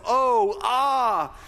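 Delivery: high, loud exclamations of awe; awed cries. Shown as a demonstration. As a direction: demonstrate the cry of awe. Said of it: "you're seeing the different uses of it".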